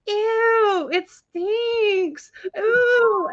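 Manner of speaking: high, drawn-out squeal of disgust; loud disgusted child. Retold or imitated. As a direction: imitate a loud little girl complaining. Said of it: "she kept getting louder".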